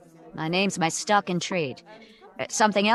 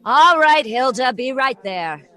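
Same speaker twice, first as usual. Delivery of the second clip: calling back; 5 semitones higher, 6 dB louder, slower than usual. Acted.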